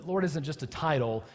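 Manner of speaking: normal talking voice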